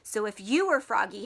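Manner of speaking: usual speaking voice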